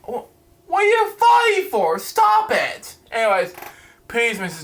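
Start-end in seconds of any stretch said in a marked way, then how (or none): 0.7-3.0 much higher, incredulous yelp; exasperated outburst. Real, not acted. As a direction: shoot up in disbelief at what you just read. Reader exasperated by the script.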